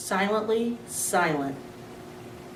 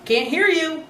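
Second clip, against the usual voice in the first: raised, higher call; dad calling back over the noise; 9 semitones higher, 3 dB louder, slower than usual. A narrator's reading. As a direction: shout back across the house. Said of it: "Dad responded".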